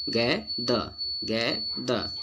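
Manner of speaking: गैद in two slow beats, गै-द; slow model reading of गैद, no r; correct silent-r pronunciation. Shown as a demonstration. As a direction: sound out गैद with an open vowel ending. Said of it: "silent होता है".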